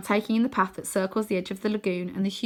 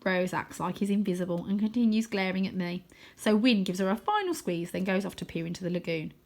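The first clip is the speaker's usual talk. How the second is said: ordinary delivery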